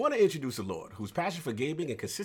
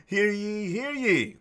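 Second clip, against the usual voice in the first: ringing, raised crier's call; town crier; 7 semitones higher, 5 dB louder, slower than usual. Pretend. Podcast host opens like crier.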